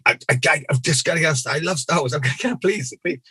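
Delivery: high, pleading and eager; hammed-up pleading for a Star Wars job; begging. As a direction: beg like a desperate fan.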